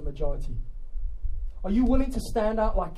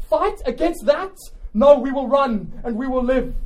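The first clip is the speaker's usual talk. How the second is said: raised, loud retort; higher-pitched objection from the ranks; old warrior interjecting; 6 semitones higher, 6 dB louder than usual. Acted. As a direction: answer the commander loudly in protest. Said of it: "an old warrior says this".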